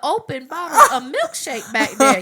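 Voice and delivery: loud, high, laughing out the words. Real, not acted. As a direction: tease her while cracking up. Laughing while teasing co-host.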